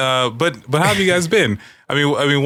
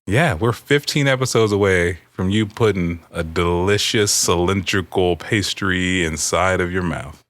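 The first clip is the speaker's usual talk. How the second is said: slow teasing build; 3 semitones lower, slower than usual. Acted. Coy euphemism for eating cake.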